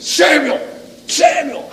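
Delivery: high, firm summons; commanding. Shown as a demonstration. God wakes Samuel in the night, commanding. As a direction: call the name as an order. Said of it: "Is it with command?".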